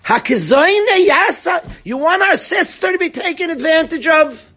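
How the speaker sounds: high, forceful scream of protest; shouted verse. Acted. Teacher screams the brothers' answer to Yaakov, shouting. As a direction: shout the verse as an indignant retort. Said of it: "I'm screaming this one. This pasuk shouts".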